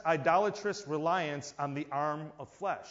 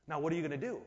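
ordinary talk, nothing marked

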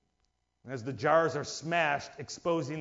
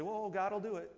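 passive believer; 10 dB quieter than usual. Retold or imitated. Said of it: "just say".

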